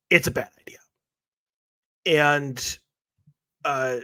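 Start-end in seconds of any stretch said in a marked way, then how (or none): 0.1-0.8 whispered aside. Acted. Answers own mock question aside.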